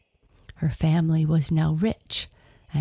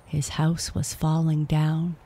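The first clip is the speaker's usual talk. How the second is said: usual speaking voice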